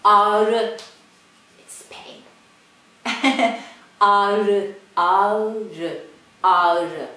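ağrı with a long a and tapped r; Turkish soft g. Shown as a demonstration. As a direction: say ağrı as aarı. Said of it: "Let me see".

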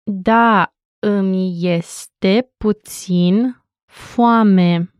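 each syllable drawn out slowly; slow, over enunciating. Shown as a demonstration. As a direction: spell it out slowly for learners. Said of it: "You'll hear it one more time slowly".

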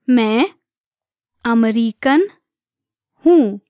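separated words, evenly spaced for learners. Shown as a demonstration. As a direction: say each word alone, leaving space to repeat. Teacher breaks phrase down for learners.

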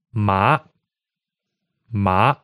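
MA rising steadily to the top; second tone. Shown as a demonstration. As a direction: say "ma" like a questioning "huh?". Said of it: "hear it with the word ma again in second tone".

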